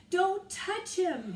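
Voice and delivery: loud, high, slow shout. A narrator's reading. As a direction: drag out each word in a shout. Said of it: "he shouted".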